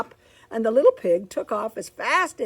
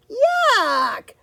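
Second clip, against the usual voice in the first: piggy disgust; 12 semitones higher, 8 dB louder than usual. Acted. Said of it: "said the first little pig".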